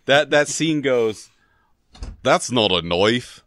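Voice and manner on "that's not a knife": slow, bad Australian accent on the knife line; terrible Australian accent. Quoting Crocodile Dundee in a bad Australian accent. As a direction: ham up a weak Australian accent. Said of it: "Forgive my terrible Australian accent".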